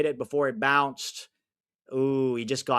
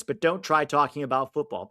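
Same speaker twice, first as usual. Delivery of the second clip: ordinary delivery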